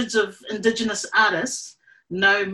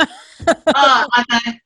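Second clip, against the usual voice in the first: laughing; 3 semitones higher, 8 dB louder, much slower than usual. Real, not acted. Laughing along with host.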